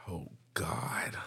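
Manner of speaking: whispered exasperated oh God; unvoiced dismayed whisper. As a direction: breathe it out under your breath. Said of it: "And I was like".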